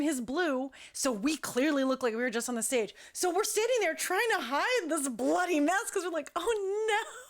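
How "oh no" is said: mock dismay. Retold dismay while hiding blood.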